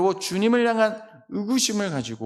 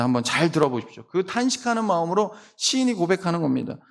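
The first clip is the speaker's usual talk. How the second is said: ordinary delivery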